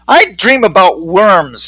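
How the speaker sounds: slow, faraway musing; dreamy Leslie. Acted. Leslie tells Eugene her dreams, slow and dreamy. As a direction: drift through it slowly. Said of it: "Leslie said".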